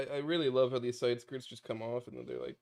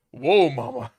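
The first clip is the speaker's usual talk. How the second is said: ordinary talk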